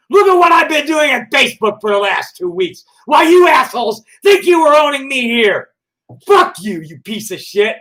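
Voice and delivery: shouted high and loud, outraged; furious shout. Real, not acted. Shouting down trolls who mocked him, furious. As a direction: yell it at them, indignant.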